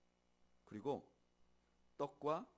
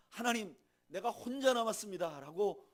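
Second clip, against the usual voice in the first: resentful complaint; 8 semitones higher, 9 dB louder than usual. Retold or imitated. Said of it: "원망스럽게 말하는".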